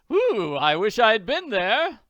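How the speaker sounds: loud, high, drawn-out delight; pleased doctor. Acted. Doctor hearing about the fight, pleased. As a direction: gush slowly with envious glee. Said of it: "The doctor is pleased".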